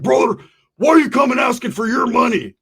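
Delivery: high, loud outraged yell; angry shout. Retold or imitated. Neighbor with a bat yelling at the guys he owes.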